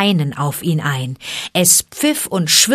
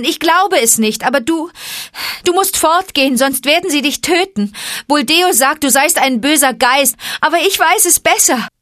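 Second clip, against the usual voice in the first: much higher, urgent pleading mother; desperate mother; 9 semitones higher, 4 dB louder than usual. Acted. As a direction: warn your son, fearing for him. Messua begs Mowgli to flee. (Messua fleht ihren Sohn an, viel höher)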